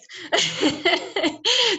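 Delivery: bright burst of laughter; laughing. Real, not acted. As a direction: laugh at running out of breath. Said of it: "I'm running out of breath".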